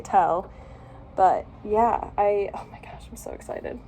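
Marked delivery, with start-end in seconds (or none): 2.5-3.8 whispered and softer, thrilled; giddy whisper. Real, not acted. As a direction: whisper it, bursting inside. Giddy over new tattoo.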